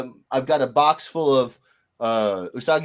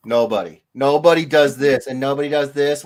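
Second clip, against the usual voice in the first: ordinary delivery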